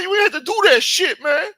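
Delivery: loud falsetto squeal of mock outrage; Hakeem, first try. Hakeem scolding the shooter in the car, squealed falsetto. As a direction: squeal the scolding far above your own voice. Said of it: "is that how he talks?".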